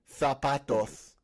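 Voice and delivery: "Zapatos" slow, with a lisped "th" ending, "zapatoth"; mock the Castilian lisp on "Zapatos"; Spain Spanish accent. Mocking how people from Spain speak, slow lisped Castilian accent. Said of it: "not the Spain Spanish".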